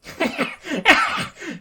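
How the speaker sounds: high, loud monster cackle; hammed-up evil laugh; villain laugh. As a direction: cackle like a gleeful alien villain. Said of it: "The red symbiote simply laughs".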